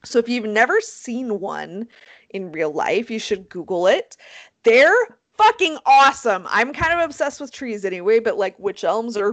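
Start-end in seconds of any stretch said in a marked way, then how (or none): none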